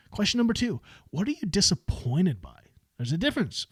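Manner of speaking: ordinary talk, nothing marked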